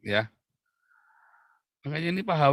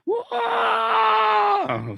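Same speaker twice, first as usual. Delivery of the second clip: long, high, loud open-throated shout; demonstrated yelling; 5 dB louder than usual. Shown as a demonstration. Preacher showing how to yell in a studio, long shout. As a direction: yell it out to vent stress. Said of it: "teriak-teriak di dalam".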